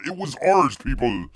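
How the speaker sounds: loud proclamation of "ours"; proud declaration. Acted. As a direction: boom it out like a rallying cry. Bear claims Ireland for bears.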